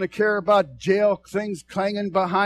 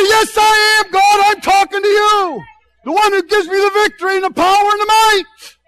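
high and loud, fervent answer; shouted reply to God; 12 semitones higher, 8 dB louder than usual. Retold or imitated. Preacher answering God before the congregation, loud. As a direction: shout back in triumph. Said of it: "I say".

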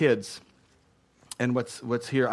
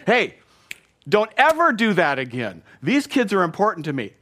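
a raised, louder warning; stern rebuke; 4 semitones higher, 4 dB louder than usual. Retold or imitated. Jesus warning his church staff, a stern raised rebuke. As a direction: scold your own staff firmly. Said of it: "This is him talking to his own church staff".

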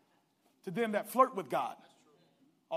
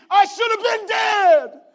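slow, high, loud anguish; Joseph's anguished thought; 10 semitones higher, 17 dB louder, slower than usual. Acted. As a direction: cry it out as a man who nearly died. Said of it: "He's thinking".

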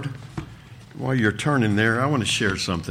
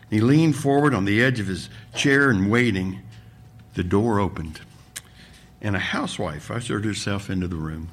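ordinary talk

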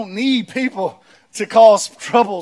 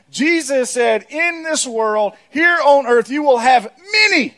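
raised, emphatic pulpit delivery; forceful preaching; 6 semitones higher, 4 dB louder, slower than usual. Acted. Preacher driving home Jesus' warning, forceful. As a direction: build to a loud, high punch on "many".

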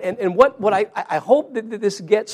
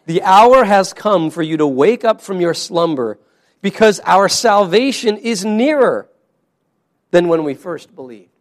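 scripture reading; 7 dB louder than usual. Shown as a demonstration. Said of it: "reads the scripture verse".

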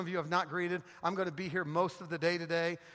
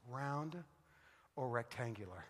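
low, hushed deadpan with a pause; solemn wife's question; 8 semitones lower, 13 dB quieter than usual. Retold or imitated. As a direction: imitate your wife asking softly and gravely. Wife's solemn question on phone, punchline.

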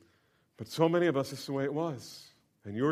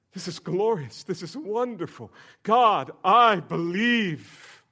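higher and louder, swelling into belief; rising wonder; 7 semitones higher, 9 dB louder, slower than usual. Retold or imitated. Young man at the lake, swelling with joyful belief. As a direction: let the awe burst into joy. Retelling moment of conversion.

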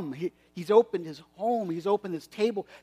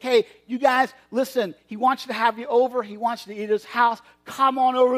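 higher and louder, calling out; excited calling out; 6 semitones higher, 4 dB louder than usual. Acted. Servant calling the mechanics to the banquet, excited. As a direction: wave them over eagerly.

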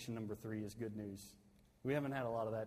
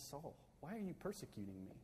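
much softer, gently searching question; voice of Jesus; 2 semitones higher, 8 dB quieter than usual. Retold or imitated. Pastor voicing Jesus calling to Saul, soft and pleading. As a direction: ask it quietly, as a pleading voice from above. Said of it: "He said to Saul on the road to Damascus".

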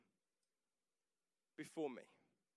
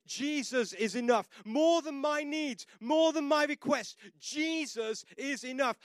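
higher, louder, slow preacher's cry; full-force preaching; 6 semitones higher, 5 dB louder than usual. Acted. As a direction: build it into a shouted declaration. Preaching climax.

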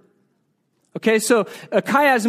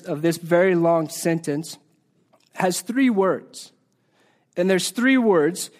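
ordinary talk

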